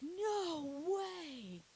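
high, loud, drawn-out disbelief; a big, incredulous outburst; shocked refusal. Retold or imitated. Telling the agency woman there's no way, loudly incredulous.

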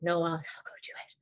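soft, unvoiced whisper; a hushed little whisper; whispered reply. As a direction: whisper it back, barely there. Daughter's timid reply retold.

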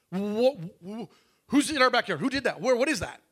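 retold alarm. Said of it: "and she says".

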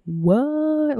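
amazed. Real, not acted. Amazed at the resurrection.

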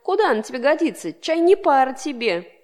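put-on an old horsefly scolding his nephew; grumbling uncle. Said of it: "сел на ветку и заворчал". (ворчливо, с упрёком)

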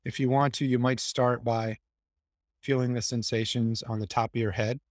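low, even meditation voice with long pauses; guided meditation. Acted. Guiding the group to relax and release tension, calm meditation voice. Leads a guided body scan.

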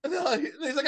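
ordinary talk

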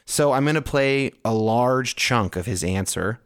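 usual speaking voice

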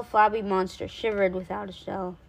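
ordinary delivery, nothing marked

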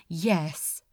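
ordinary delivery

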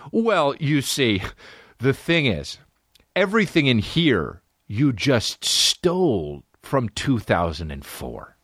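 slow, drawn-out explaining; mock patient explanation. Customer explaining to a puzzled clerk, mock patient and slow.

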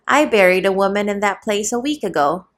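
plain reading voice

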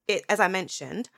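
ordinary delivery

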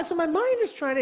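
normal talking voice

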